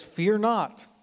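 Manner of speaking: loud, high, firm command; rally a frightened crowd; bold encouragement. Retold or imitated. Moses calling to frightened Israel, loud and firm.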